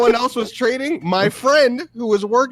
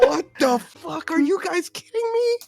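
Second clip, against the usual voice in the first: Goldfish catching the scam in shock; mock outraged disbelief; 9 semitones higher, 3 dB quieter than usual. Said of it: "He'd be like".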